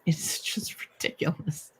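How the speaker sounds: whispered. Real, not acted. Muttered aside about author admin.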